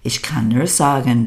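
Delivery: normal talking voice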